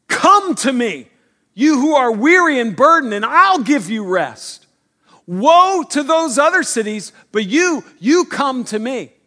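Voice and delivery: loud, high, urgent call; preacher booming Jesus' invitation to the weary; forceful invitation. Acted. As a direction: proclaim it with force, pressing each word. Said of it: "has to land with a, a kind of force".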